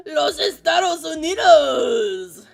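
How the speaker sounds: a loud, drawn-out, high mock shout; mock yell. To the chat, a hammed-up, loud, drawn-out yell. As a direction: belt out the country name to the chat, hamming it up. Exaggerated exclamation on stream.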